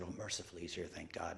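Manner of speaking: very soft relieved murmur; relieved mutter. Retold or imitated. Narrator relieved the dispatcher came, soft murmur. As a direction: breathe it out in relief.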